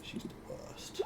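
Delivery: whispered grumble; soft, whispery complaint to yourself; grumbled aside. Real, not acted. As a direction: mutter it under your breath, barely voiced. Grumbling after shield blocks attacks.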